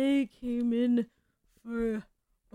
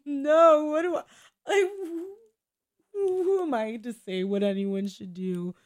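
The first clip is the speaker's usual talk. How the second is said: mock panic; 4 semitones higher, 6 dB louder than usual. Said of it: "I'm just like".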